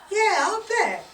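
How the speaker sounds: loud, high sarcastic jab. Acted. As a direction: throw it back with disbelief. Wife's sarcastic reply.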